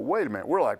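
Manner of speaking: ordinary talk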